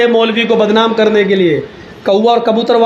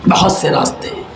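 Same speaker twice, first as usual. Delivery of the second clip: whispered echo; 3 semitones lower than usual. Acted. Preacher whispering an aside to the audience. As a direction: drop it to a sly whisper.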